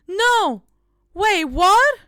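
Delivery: sudden alarmed "wait, what"; startled. Real, not acted. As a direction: catch yourself in a mistake. Notices the accidental time-out.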